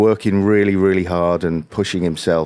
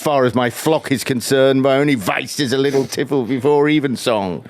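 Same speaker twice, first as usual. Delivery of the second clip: higher-pitched mock-solemn confession; Blackadder character; 5 semitones higher than usual. Quoting Blackadder to the other musician, high hammed-up voice.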